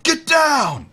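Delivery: high, loud shout of command; yelled order. Acted. Squad leader yelling at the riflemen, shouted command. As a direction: yell it like a leader under fire. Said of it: "basically yelling at them and saying".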